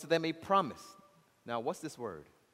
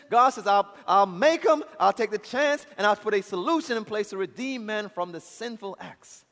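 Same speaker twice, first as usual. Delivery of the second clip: loud, insistent pulpit proclamation; impassioned preaching; 6 semitones higher, 8 dB louder than usual. Real, not acted. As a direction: drive each phrase home with a raised voice. Preaches God's plan at full force.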